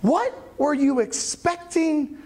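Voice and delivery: emphatic acted exasperation; exasperated angels. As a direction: scold slowly, as if shaking your head. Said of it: "angels sitting there just shaking their head".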